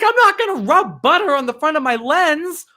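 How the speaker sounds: real indignant outburst; heated disbelief. Real, not acted. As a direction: rant at the silly hack. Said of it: "Sorry, I get heated about that".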